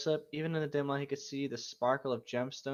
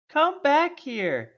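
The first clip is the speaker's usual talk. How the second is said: high, loud, slow hammed-up yell; shout; 12 semitones higher, 9 dB louder, much slower than usual. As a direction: bellow after the fleeing thief. [name] shouts after thief.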